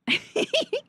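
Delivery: quick, very high-pitched giggle. Real, not acted. Amused by the ogre line.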